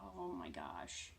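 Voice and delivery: a hushed, breathless oh my gosh; awed whisper. Retold or imitated. Heroes sensing Thanos arriving, hushed whisper.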